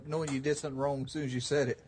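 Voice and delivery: ordinary talk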